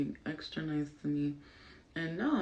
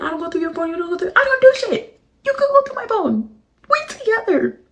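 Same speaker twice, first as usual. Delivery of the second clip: much higher, squeaky mocking parody; mocking boyfriend; 17 dB louder than usual. Mocking the boyfriend's excuse in a squeaky voice. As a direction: throw his words back in a silly voice. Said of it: "Talk about".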